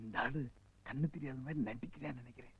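very soft, low secret aside; conspiratorial murmur. Acted. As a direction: share a suspicion so he can't overhear. Secret suspicion shared aside. (ரகசியமாக, மிக மெல்லிய குரலில்)

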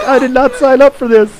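hammed-up whiny protest; mock complaint. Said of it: "He's like".

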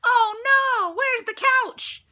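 high, loud panicked cry; hammed-up dismay; alarmed oh no. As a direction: panic at the missing couch. Family discovers couch missing.

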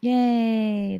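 ordinary talk, nothing marked

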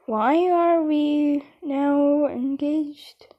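drawn-out, slow confused question; panicked slow plea; slow and bewildered. Real, not acted. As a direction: stretch every word out in disbelief. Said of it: "Because I'm panicking".

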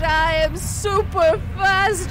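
slow, drawn-out gloating; taunting boast. Pretend. Player taunting the monster while dodging, slow mock-heroic boast. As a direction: stretch out the taunt, rising at the end.

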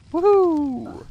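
high, loud whooping cheer; a squealed, playful woohoo; Flitwick. Acted. Player copying a game character on a table, high whoop. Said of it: "I can be like Flitwick".

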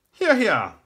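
high, loud cheer of assent; crying out. A narrator's reading. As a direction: cry out like approving courtiers. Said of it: "The others cried out".

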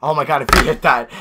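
ordinary talk, nothing marked

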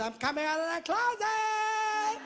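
ordinary talk

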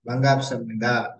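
반갑습니다 run together quickly as one phrase; quick, joined-up 반갑습니다 for a learner; fluent model reading. Shown as a demonstration. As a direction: model 반갑습니다 fast and smooth. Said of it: "Like this".

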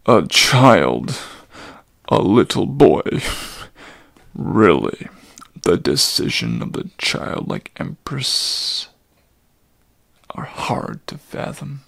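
put-on low, loud, aghast old centaur; shocked old centaur. Old Chiron appalled that the hero is a child, low loud voice. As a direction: burst out low in disbelief.

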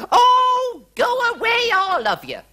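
loud, very high exasperated shriek; shrill royal outburst, easing down at the end; queen's cry. A narrator's reading. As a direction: shoo them off at full pitch as the queen. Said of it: "cried the Queen".

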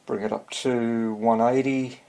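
usual speaking voice

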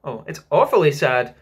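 put-on loud, lightly cheerful child; mildly chirpy little twin. A narrator's reading. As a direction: say the sad words brightly. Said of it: "the first twin said cheerfully".

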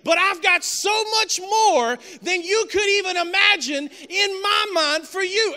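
high, loud preacher's shout at a son; impassioned preaching rebuke. Retold or imitated. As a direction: build from exasperated dad to full-force preaching. Father erupts at whining son, preaching.

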